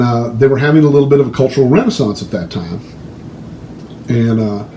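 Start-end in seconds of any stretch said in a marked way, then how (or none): none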